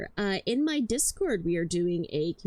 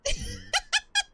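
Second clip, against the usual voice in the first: high squealing laugh. Real, not acted. Laughs at own joke.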